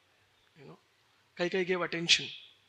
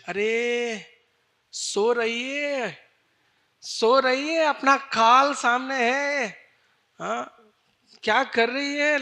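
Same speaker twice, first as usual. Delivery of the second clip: urgent rousing; 3 semitones higher, 6 dB louder, faster than usual. Acted. Mantra rousing sleeping Kaikeyi.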